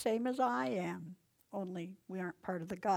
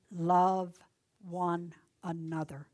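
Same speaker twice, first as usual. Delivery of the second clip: Love hit much louder, pause before one another; preacher hammering Love; stress on Love; 3 semitones lower, 7 dB louder, slower than usual. Acted. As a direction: punch Love, then let one another follow. Repeated command, love punched.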